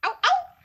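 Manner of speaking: high sharp ow cries; pained yelps. Acted. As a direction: yelp out in pain. Hare cries out in pain.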